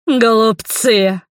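slow, drawn-out, gloating cry; vile gloating villainess. A narrator's reading. Fiery woman mocking her foes, gloating vilely. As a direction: draw it out with nasty relish. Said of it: "захохотала она мерзким смехом".

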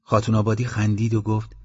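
usual speaking voice

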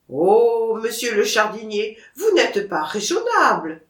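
put-on loud, indignant Auvergnat accent; Auvergnat protest. Seller objects to the gardener's price, loud Auvergnat accent.

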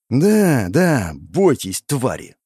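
stretched-out mocking glee; grinning taunt at the scared enemy; gloating grin. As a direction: savour the fear with a wide grin. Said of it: "улыбался во весь рот Рандел".

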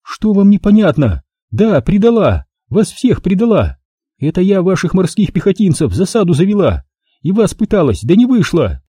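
screaming with hatred, high; hateful shout. A narrator's reading. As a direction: hurl the confession in his face. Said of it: "уже почти с ненавистью выкрикнула".

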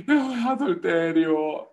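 slow sheepish confession; slow mock confession. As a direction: drag out the sinner's admission. Sinner confessing in skit.

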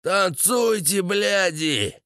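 high rowdy yell; hoarse drunk bellowing at the girls. Acted. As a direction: drunk soldier yelling at dancing girls. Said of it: "Сиплым голосом орал Федя".

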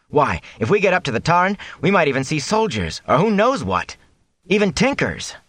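high-pitched, wondering enthusiasm; excited persuasion. A narrator's reading. Matt tempts his friend to join the watch, excited and high.

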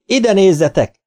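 high, loud call to look; proud hunters calling out; shouted. A narrator's reading. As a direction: shout it to the whole beach. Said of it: "kiáltották".

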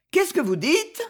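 as if the floor dropped away; shocked question. Acted. Varvara recoiling from the count's hint, shocked. Wife hears husband was doomed.

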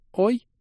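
very high, short squeal; startled squeak. A narrator's reading. Girl caught hiding her romance from father, startled squeak. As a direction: squeak in alarm. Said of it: "пискнула Алиэла Седри".

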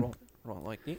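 soft mock New Zealand accent; quiet stab at New Zealand speech. Shown as a demonstration. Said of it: "all the vowels got shortened up".

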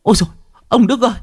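put-on very high, frantic pleading of a terrified old wife; panicked wife. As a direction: cry out at him in alarm, rushing. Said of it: "Bà Đức kinh hãi".

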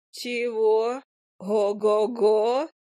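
slow, stretched exclamation, rising; astonished fairy. A narrator's reading. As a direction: draw out the amazed reaction. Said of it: "высказала вдруг Фая". (изумлённо, протяжно, с подъёмом)

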